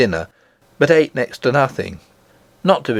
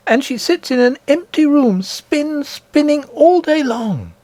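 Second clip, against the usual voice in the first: put-on an eager child telling a wonder; little princess. Princess telling nurse of her grandmother, high and eager.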